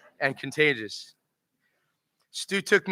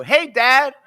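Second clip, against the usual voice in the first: higher, louder, bright mock call to dad; cheeky loud hey; 11 semitones higher, 10 dB louder than usual. Teen son calls his dad at the drive-through window, loud and cheeky. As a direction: call out to him to expose the joke.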